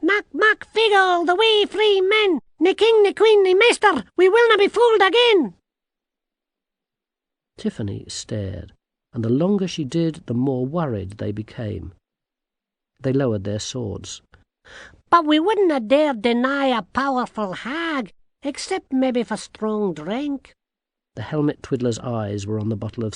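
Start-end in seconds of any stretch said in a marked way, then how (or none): 0.0-5.6 very high, loud Scots chant; little men's war cry. A narrator's reading. 15.1-20.5 high-pitched little-man voice; wheedling tiny Scottish man. A narrator's reading.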